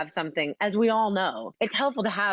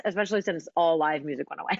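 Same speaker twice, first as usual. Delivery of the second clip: ordinary talk, nothing marked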